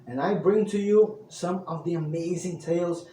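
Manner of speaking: loud, bright-eyed storyteller welcome; booming minstrel introduction; bardic host greeting. Acted. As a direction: hail the traveler like a tavern bard. Storyteller persona greets the audience.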